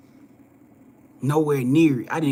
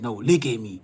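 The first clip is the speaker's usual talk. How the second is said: with authority. Retold or imitated. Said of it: "he said it with authority".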